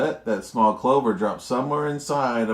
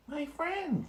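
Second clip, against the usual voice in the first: much higher, small and soft; a tiny voice from a dust speck; squeaky little mayor; tiny Who voice; 7 dB quieter than usual. Acted. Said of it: "came the voice".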